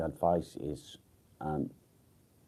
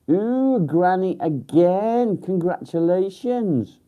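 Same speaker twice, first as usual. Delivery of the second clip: much higher and louder, slow and delighted; excited congratulations; 13 semitones higher, 10 dB louder, slower than usual. Real, not acted. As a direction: gush over the news of a new grandchild.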